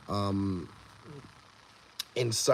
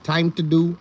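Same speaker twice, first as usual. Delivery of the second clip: a touch of mock Indian accent, pitched up; imitating Indian accent; 6 semitones higher, 7 dB louder than usual. Quoting the Indian neighbor to the chat, light mock accent. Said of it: "he used to always say that".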